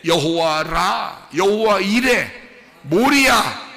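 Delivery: high, shouted proclamation; full-force preaching. Real, not acted. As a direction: thunder out the names to the congregation. Climax of the sermon.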